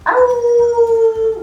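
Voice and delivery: high, sustained howl; playful dog howl. Pretend. Dog howl in kids' yoga.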